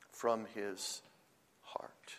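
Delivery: very soft, sinking almost to a whisper; hushed repetition. Shown as a demonstration. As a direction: let it fall away gently, word by word. Repeats phrase slowly, dropping away.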